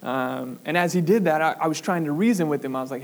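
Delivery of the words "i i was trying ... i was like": ordinary talk, nothing marked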